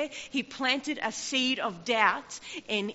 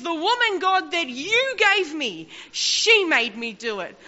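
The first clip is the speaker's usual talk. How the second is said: blaming whine; 6 semitones higher, 7 dB louder than usual. Acted. Adam blaming, acted in sermon.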